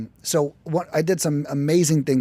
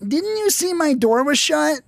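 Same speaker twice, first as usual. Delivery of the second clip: much higher, slow indignant whine; prissy office executive; 13 semitones higher, 4 dB louder than usual. Retold or imitated. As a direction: sound offended at the intrusion.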